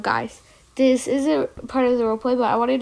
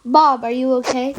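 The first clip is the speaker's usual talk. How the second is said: ordinary delivery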